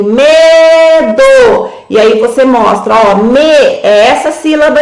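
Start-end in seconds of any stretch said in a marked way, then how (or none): 0.0-1.7 a bit louder and higher, as if leading the whole room; choral reading. Shown as a demonstration. Teacher leading the whole class in reading "medo", a bit louder. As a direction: lead the group reading of "medo". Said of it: "Agora todo mundo junto, coletivo".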